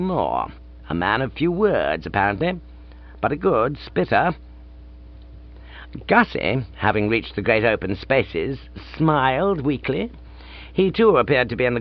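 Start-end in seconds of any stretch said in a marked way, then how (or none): none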